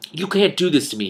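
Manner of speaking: mild panicked shriek. A narrator's reading. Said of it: "he shrieked".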